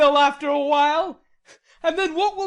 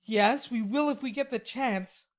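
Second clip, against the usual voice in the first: rebellious mutter; 4 semitones higher than usual. A narrator's reading. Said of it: "muttered Laurie rebelliously".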